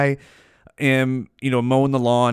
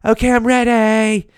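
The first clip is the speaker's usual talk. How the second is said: eager woman; 9 semitones higher, 4 dB louder than usual. Acted. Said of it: "chicks were like".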